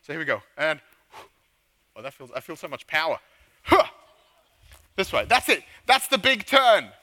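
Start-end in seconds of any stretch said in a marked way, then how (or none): 3.6-3.9 short, loud "huh" of effort; effort grunt. Pretend. Leader showing kids the powerful big turn, effort grunt. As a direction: punch out a sharp grunt.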